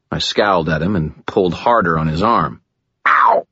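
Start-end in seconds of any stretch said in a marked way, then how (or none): 3.1-3.5 high, loud pained yelp; sharp cry of hurt; gasped yelp of pain. A narrator's reading. Man whose arm is pulled harder, gasped yelp. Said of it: "he gasped".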